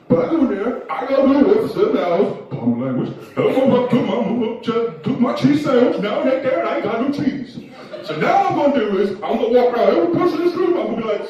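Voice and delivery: put-on high, loud drunken rambling; drunk complainer. Drunk man telling the room about the fridge, high and loud. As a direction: rant loudly, tossing in hurried apologies. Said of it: "That's what he sounds like".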